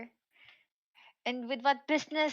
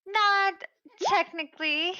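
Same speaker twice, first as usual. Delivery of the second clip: higher, louder protest; emphatic objection; 9 semitones higher, 5 dB louder than usual. Acted. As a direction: cut in with a sharp correction. Mox objecting in character.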